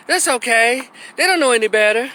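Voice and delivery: high-pitched patronizing coo; put-on condescending reassurance; mock indulgence. Imitates liberals indulging children's speech.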